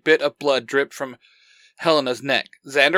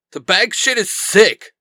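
raised, higher-pitched outburst; shouted; 6 semitones higher, 5 dB louder than usual. A narrator's reading. Derek confesses under his leader's pressure, shouting. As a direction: blurt it out loud and high. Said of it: "Derek shouted".

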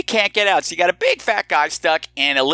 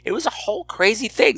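normal talking voice

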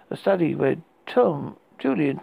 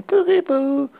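put-on high, loud eerie child call; little girl voice; 10 semitones higher, 6 dB louder than usual. A narrator's reading. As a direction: sing it out like a ghost child. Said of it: "just a little girl's voice".

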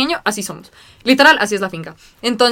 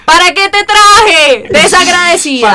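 slow, high, loud mock wail; hammed-up scolding; 8 semitones higher, 6 dB louder, much slower than usual. Retelling scolding a friend at photos, drawn-out mock-outraged wail. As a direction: draw out each word in theatrical outrage. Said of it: "Y Juan Felipe y yo".

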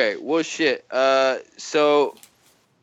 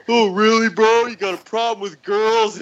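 mocking bro voice; 12 semitones higher than usual. Mocking the commenter who came at him, high sneer. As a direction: pitch way up to lampoon the accuser. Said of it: "came at me and was just like".

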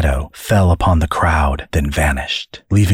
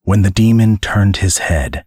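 ordinary delivery, nothing marked

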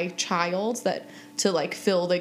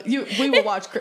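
ordinary delivery

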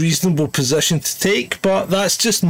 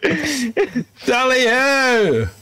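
high, slow posh battle cry; hammed-up tally ho; 8 semitones higher, much slower than usual. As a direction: call it like a hunting toff. Mock charge cry.

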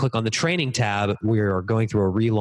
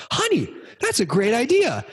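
delighted praise; 13 semitones higher than usual. Retold or imitated. Dad praising daughter's bedtime idea.

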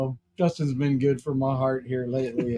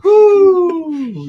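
long, high, loud whoo; excited whoop; 11 dB louder than usual. Real, not acted. Host cheering the stand-up comic, loud high whoop. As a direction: let out a big cheering holler.

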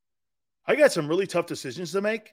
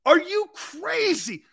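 much higher, slow, are you crazy; incredulous; 12 semitones higher, slower than usual. Real, not acted. Host mocking New York for lighting up blue. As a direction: pitch it up in disbelief.

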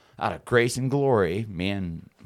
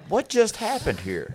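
higher, puzzled, slowed question; dazed surprise; 14 semitones higher, 3 dB louder, slower than usual. Retold or imitated. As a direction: sound stunned and confused. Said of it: "and I was like".

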